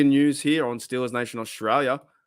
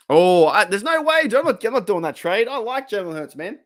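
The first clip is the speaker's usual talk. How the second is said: excited refusal; 8 semitones higher, 5 dB louder than usual. Real, not acted. Emphatic refusal of a proposed trade.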